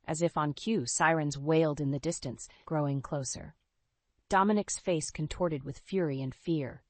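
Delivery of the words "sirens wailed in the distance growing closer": plain reading voice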